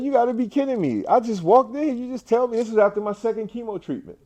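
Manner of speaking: high, stunned retold protest; disbelieving. To the nurse after his second chemo, high stunned disbelief. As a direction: replay his shock, voice held high throughout. Told nothing after stage four.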